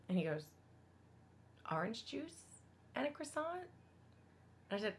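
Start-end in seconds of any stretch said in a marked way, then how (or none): 1.7-3.7 soft, higher, questioning tone; timid request. Retold or imitated. Hungry man asking a stranger for food, timid and hushed. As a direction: ask cautiously, as if it's too much.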